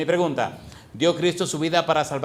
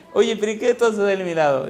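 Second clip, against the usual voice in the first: wife's teasing question; 5 semitones higher, 4 dB louder than usual. Retold or imitated. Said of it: "Ella se ríe y dice".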